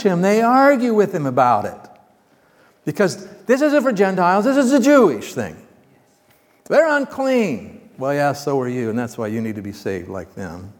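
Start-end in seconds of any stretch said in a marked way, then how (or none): 2.8-7.9 high, scandalised voicing of the objectors; indignant objection. The objectors protesting to Peter, scandalised and pitched high. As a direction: protest that Gentiles are unclean.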